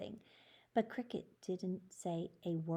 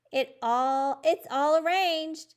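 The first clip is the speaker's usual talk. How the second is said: excited announcement; 8 semitones higher, 9 dB louder, slower than usual. Acted. Announces success to class.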